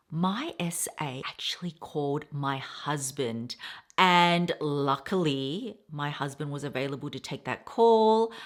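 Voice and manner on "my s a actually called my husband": conspiratorial whisper. Real, not acted. Telling viewers the surprise twist, whispering. Revealing secret plot.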